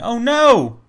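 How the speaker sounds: high, drawn-out cry of dismay; mock alarm. Reacting to prophecy destined to happen, high mock alarm. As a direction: play up the dismay, pitched high.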